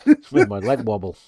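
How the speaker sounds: loud laugh, high and loud; laughing loudly through the words. Real, not acted. Bursts of laughter at joke.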